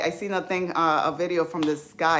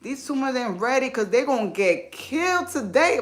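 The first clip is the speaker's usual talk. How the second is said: mom's joking voice; 6 semitones higher than usual. Retold or imitated. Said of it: "She's like".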